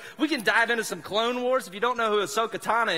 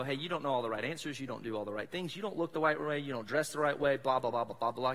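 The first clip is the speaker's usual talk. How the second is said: snuffing critic; 6 semitones lower, 6 dB quieter than usual. Retold or imitated. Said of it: "and go".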